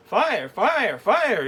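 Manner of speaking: high, loud cry of alarm; yelled alarm. Acted. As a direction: shout it out to warn everyone. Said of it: "yelled Ruby".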